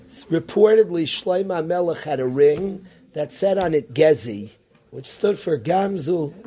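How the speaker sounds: normal talking voice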